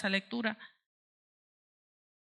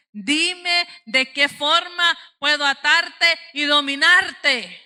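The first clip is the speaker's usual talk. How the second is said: Dalila; 6 semitones higher, 8 dB louder, much slower than usual. Acted. Preacher voices Delilah's scheming demand.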